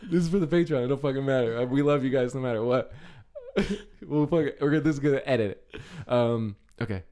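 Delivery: loud mumbling Godfather voice; marbles in mouth. Acted. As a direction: talk around a mouthful of marbles. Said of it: "The Marlon Brando with, like, marbles in your mouth".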